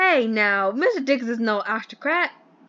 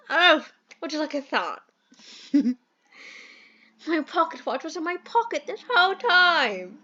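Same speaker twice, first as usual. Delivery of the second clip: posh British gentleman. Acted. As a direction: play the fussy English employer in a mild posh accent. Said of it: "I hate this accent".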